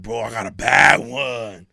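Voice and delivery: loud, drawn-out mock brag; boasting homie. Imitating homies bragging about a girl, loud drawn-out boast. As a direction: imitate a friend crowing, stretched out slowly.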